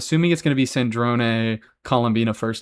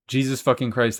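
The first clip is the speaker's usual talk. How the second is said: ordinary talk, nothing marked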